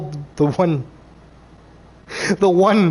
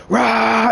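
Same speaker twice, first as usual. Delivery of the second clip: short, higher mock roar; imitated Wookiee roar; 5 semitones higher than usual. Reviewer imitating a hypnotized Wookiee attacking, mock roar. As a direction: roar like a brainwashed beast, lightly.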